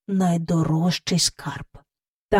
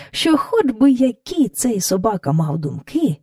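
plain reading voice

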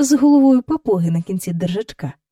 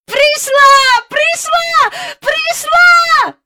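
very high, loud, jubilant yells; full-chested shout; 8 dB louder, much slower than usual. A narrator's reading. Michael grabbing at his returned nanny, jubilant shouting. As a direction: yell it with all your lungs. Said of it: "на всі груди кричав Майкл".

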